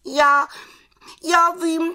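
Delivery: very high, hesitant witch voice; little witch. Acted. Little witch answering the raven, high halting voice.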